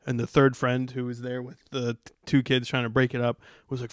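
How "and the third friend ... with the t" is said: normal talking voice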